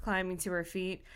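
plain reading voice

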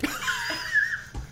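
very high shout of laughter; shrieking laugh. Real, not acted.

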